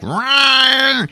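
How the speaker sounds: high, sudden mock yowl; hammed-up creature cry; monster cat screech. Imitates the eyeless cat's screech.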